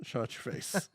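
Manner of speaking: unvoiced, slow mock scold; playful shut up. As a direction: hiss it in playful annoyance.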